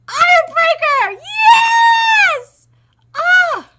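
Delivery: high, loud, slow battle cry; triumphant shout. Acted. Fighter lands his attack, shouting in triumph. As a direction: roar the move then cheer.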